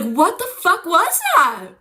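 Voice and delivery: high, slow, stretched-out disbelief; alarmed outcry. Retold or imitated. Friends holding knives react, high drawn-out outcry. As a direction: cry it out in hammed-up alarm.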